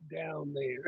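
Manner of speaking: usual speaking voice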